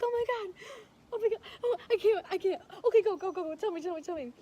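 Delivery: high, loud, frantic urging; excited mom. Retold or imitated. Woman imitating mom grabbing phone for news, frantically excited. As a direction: imitate an overwhelmed mom, urgently repeating herself. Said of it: "my mom was just so excited".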